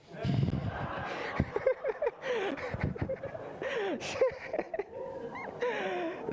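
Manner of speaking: a long high burst of real laughter; laughing at the joke. Real, not acted. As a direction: crack up at the punchline.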